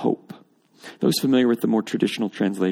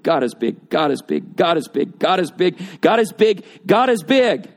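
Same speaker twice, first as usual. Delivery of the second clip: building refrain; 4 semitones higher than usual. Retold or imitated. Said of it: "as you spent the last multiple verses saying".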